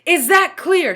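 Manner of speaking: high loud insistent question; stern repeat. A narrator's reading. Teacher pressing Todd, high loud repeat. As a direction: drive it home again. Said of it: "she asked again".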